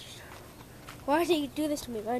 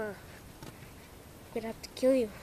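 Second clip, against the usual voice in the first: mock menace; 4 dB quieter, much slower than usual.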